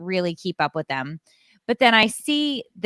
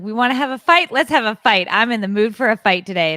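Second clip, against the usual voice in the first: mock fight challenge; 3 semitones higher, 4 dB louder, faster than usual. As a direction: throw down the challenge to the camera. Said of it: "yelling into the camera".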